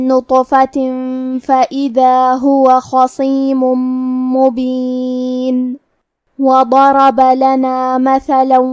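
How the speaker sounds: slow drawn-out tajweed chant; Quran recitation. Acted. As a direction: recite slowly, stretching each vowel. (ترتیل کے ساتھ تلاوت)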